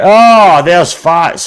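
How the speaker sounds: loud, high cry of recognition; excited greeting. Real, not acted. Streamer spotting Fats in the chat, excited. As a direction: hail a friend with a sudden high burst.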